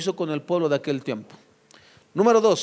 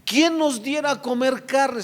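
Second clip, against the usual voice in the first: mild mock lament, higher and drawn-out; imitando un lamento; 7 semitones higher, slower than usual. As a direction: wail it lightly, slow and raised in pitch. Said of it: "empezaron a llorar". (lamento fingido, agudo y alargado)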